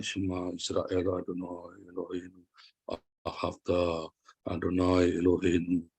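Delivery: low, slow monotone prayer chant, imitated; mumbled recitation. Preacher showing how Israel prays while swaying, low mumbled recitation. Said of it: "Cuma gini-gini aja, kumat tamit".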